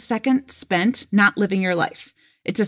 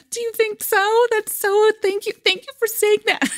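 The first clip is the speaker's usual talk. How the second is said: much higher, gushing mock delight; flattered squeal; 13 semitones higher than usual. Gushing back at a client who says she'd be picked. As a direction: squeal your thanks like you've been complimented. Retold own flattered reply.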